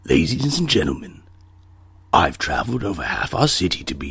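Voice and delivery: Daniel Plainview impression. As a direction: address the crowd like a grand, obsessive tycoon. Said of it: "character in it's named Daniel Plainview".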